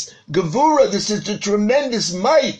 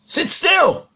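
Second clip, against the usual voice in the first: high-pitched, insistent parental order; much higher, sterner command to a child; 10 semitones higher than usual. Retold or imitated. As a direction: repeat the order with rising urgency. Father repeats the command, sharper.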